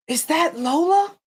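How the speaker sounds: excited high gasp of recognition; recognizing someone famous; surprised gasp. Retold or imitated. Stranger recognizing daughter, gasping.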